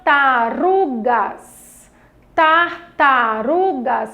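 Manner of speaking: TARTARUGAS very slow, syllable by syllable, RU stressed. Shown as a demonstration. Teacher asks which syllable is most intense, Tartarugas slowly stressed on RU. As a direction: draw out Tartarugas, leaning on RU. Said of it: "falei com maior intensidade".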